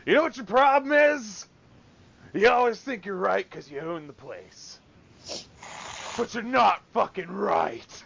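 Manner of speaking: loud, high, furious telling-off; retold angry outburst. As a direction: chew out the boss in front of everyone. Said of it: "I'm like".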